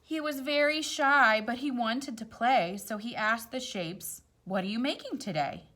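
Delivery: gentle storybook read-aloud; rhyming story voice; reading to children. A narrator's reading. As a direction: read it like a picture book at bedtime. Reads picture book aloud.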